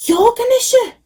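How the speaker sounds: high, loud astonished cry; an old man amazed to meet a god; exclaiming. A narrator's reading. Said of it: "the old man exclaimed".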